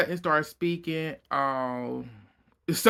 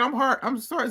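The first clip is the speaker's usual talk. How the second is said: mock apology; 9 semitones higher, faster than usual. Mocking Sutton's shaky apology.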